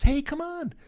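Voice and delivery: high, eager director impression; Quentin Tarantino. Director invites the kid to the car, high eager voice. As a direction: beckon in a keyed-up high voice. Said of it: "He goes down there and goes".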